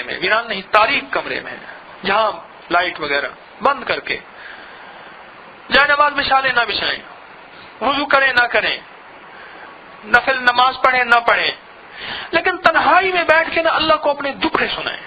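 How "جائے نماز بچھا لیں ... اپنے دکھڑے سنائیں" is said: fervent preaching. Acted. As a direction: urge the crowd with rising fervor. (جوش سے وعظ)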